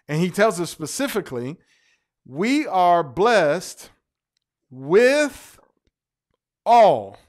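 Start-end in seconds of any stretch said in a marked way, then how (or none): 2.2-7.2 slow build to a loud "all"; stress on WITH ALL. Shown as a demonstration. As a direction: punch "with" and "all". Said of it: "W I T H, all caps, A L L".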